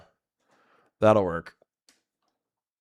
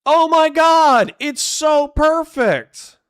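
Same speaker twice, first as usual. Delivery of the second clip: very high, loud, drawn-out gushing; ecstatic squeal; 8 dB louder, slower than usual. Real, not acted. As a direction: squeal it slowly in sheer delight. Delighted reaction to a joke.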